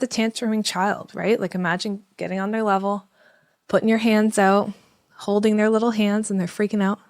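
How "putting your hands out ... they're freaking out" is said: ordinary talk, nothing marked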